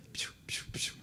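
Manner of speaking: soft unvoiced piu piu, hissed between the teeth; imitated psst call. Friend calling a European waiter with a soft piu. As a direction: hiss softly to call a waiter. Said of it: "E ele chamou o garçom assim".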